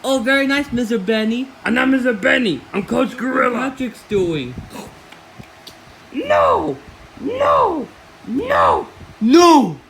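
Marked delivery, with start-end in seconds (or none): 6.1-9.8 repeated high protest, getting louder; high wailing no. Acted. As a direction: whine it again and again.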